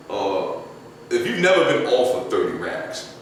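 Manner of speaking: ordinary talk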